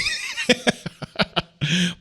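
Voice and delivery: high rising chuckle; amused laugh at getting sidetracked; light laugh. Real, not acted. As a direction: let a laugh roll out. Laughs after the aside.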